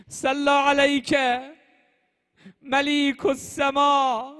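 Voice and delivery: high, loud elegiac recitation; impassioned, chant-like rawzah delivery; mourning lament. Acted. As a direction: wail the elegy out at full force. Mourning elegy at full force.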